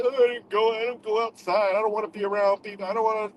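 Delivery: mock the stay-inside crowd; mock whiny recluse. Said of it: "People be all like".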